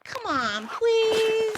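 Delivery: whiny begging, rising high on please; kid's wheedling plea; pleading child. Acted. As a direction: beg Dad, stretching the last word up high. Child begging his dad.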